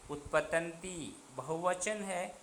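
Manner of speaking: ordinary delivery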